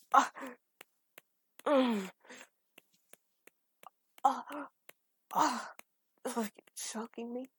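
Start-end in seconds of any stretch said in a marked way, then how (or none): 1.7-2.1 moan. Acted. Moan in the scene. 5.3-5.7 moan. Acted. Moan in the scene.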